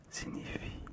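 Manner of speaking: normal talking voice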